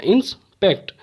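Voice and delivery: "Inspect" broken as इंस, pause, पेक्ट; split into syllables. Shown as a demonstration. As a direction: stop briefly midway through "inspect". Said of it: "दो बार में ही यह word complete". ("Inspect" को दो हिस्सों में रुककर)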